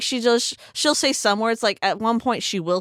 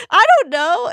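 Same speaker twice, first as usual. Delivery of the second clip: high, loud helpless reply; acted exasperation; 12 semitones higher, 7 dB louder than usual. Retelling own answer to the frantic friend, acted exasperation. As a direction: throw it back high and exasperated.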